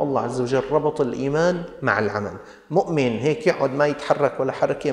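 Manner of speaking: ordinary delivery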